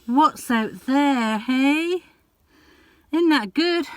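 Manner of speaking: high, loud cooing to the cat; pet talk. Real, not acted. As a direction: coo at the cat with delight. Owner talks to her cat.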